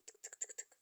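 usual speaking voice

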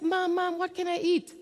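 lightly voiced child; child asking. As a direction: play the eager hungry kid.

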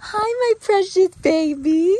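high, loud, slow cooing; doting owner cooing at her foal, high and slow. Real, not acted.

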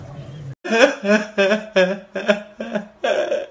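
amused chuckle at the broken egg; short laugh. Real, not acted. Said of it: "इतनी हंसी आ रही है".

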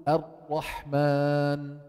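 slow الرحمن, ra held without rolling; untrilled ra. Shown as a demonstration. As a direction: hold the tongue still on the ra of الرحمن. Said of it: "شو مطلوب تقول".

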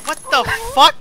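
high, loud shocked outburst; startled shout. Real, not acted. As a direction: blurt it out in shock. Startled by enemy.

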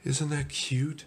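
high, slow, tender coo; dreamy little donut voice; charmed cooing. Acted. Arnie charmed at being lifted.

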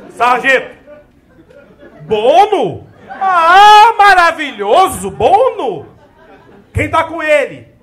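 sarcastic delight. Retold or imitated. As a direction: gloat over the find before the hunt. Sergeant mock delight over snacks.

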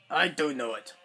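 put-on robot character talking back. Toy robot arguing with its owner, put-on stubborn voice. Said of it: "Shut up, B B eight".

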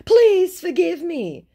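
slow, high, loud imitation of begging; mock pleading. Scammer begging to be forgiven, mock pleading. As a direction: drag out a hammed-up plea for forgiveness.

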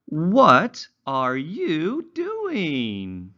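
slow, high, sing-song storybook reading; reading to children. Acted. Teacher reading a simple story to young English learners, slow and sing-song. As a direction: read it slowly like a picture book for little ones.

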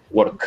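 "work" said high, with a clearly released k at the end; crisp final k. Shown as a demonstration. Teacher models the clear ending for the class, crisp k. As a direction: snap the k of "work" cleanly. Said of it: "Que sea claro".

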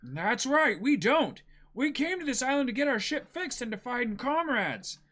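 put-on high, loud carefree boy; cheeky young pirate calling out; brash boy hero. S0 voices Luffy reading dialogue.

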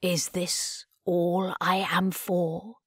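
put-on quiet, slow lost old creator; soft despairing Davros. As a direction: ask it softly, defeated.

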